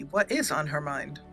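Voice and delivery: ordinary delivery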